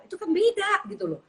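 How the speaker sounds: ordinary delivery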